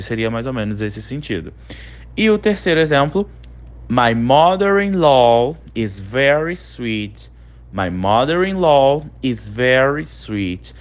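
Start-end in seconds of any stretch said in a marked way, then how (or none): 3.9-7.3 slow model reading. Shown as a demonstration. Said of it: "Minha sogra é muito doce comigo". 7.7-10.7 slow model reading. Shown as a demonstration. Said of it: "Minha sogra é muito doce comigo".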